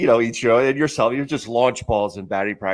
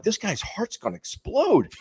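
high, softer, drawn-out disbelief; mock alarm; 10 semitones higher, 5 dB quieter, slower than usual. Player watching the manager pace and smoke, mock alarmed. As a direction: act worried amazement, slow and high.

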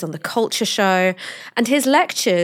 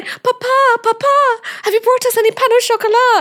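very high, loud mock child voice; squeaky, hammed-up posh kids; posh children; 6 dB louder than usual. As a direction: imitate posh little children pleading with papa. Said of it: "his really posh children".

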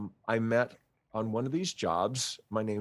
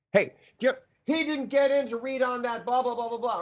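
flabbergasted agent; 13 semitones higher than usual. Retold or imitated.

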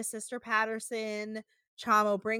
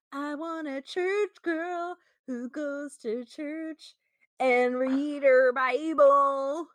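slow, drawled meme-quote voice; quoting a vine; 4 semitones higher, much slower than usual. Retold or imitated. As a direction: recite it slowly like the famous vine. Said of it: "I'm just thinking in vines".

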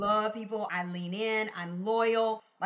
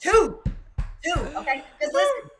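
much higher and louder; excited; 9 semitones higher, 10 dB louder than usual. Real, not acted. Guest counting her second lesson to the host, excited. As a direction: pump it up emphatically. After shared laughter, loud.

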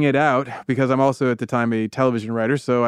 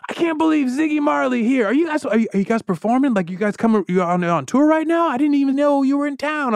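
starstruck fan; 11 semitones higher than usual. Retold or imitated. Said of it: "he says".

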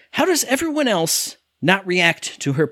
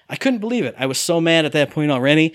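normal talking voice

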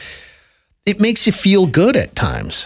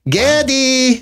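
put-on light Polish accent, high-pitched Geddy; 10 semitones higher than usual. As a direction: mimic a mom's Polish accent calling Geddy. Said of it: "his mom had a very thick Polish accent".

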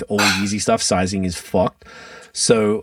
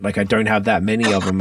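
ordinary talk

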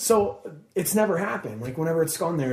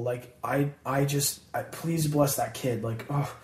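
ordinary delivery

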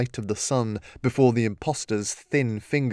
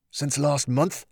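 angry surprise; 3 semitones higher than usual. Acted. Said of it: "his voice filled with anger and surprise".

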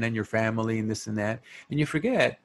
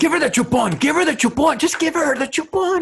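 high, loud pleading shout; mock exasperated yell; 8 dB louder than usual. Joking at the crying baby's parent, high exasperated mock yell. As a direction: yell it over and over in comic desperation.

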